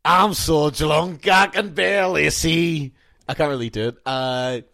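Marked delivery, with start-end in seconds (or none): 0.0-2.9 put-on loud, slow Scottish drunk; attempted Scottish accent, higher and louder; slow, shouty Scots character voice; Groundskeeper Willie. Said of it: "I'm gonna start with Groundskeeper Willie".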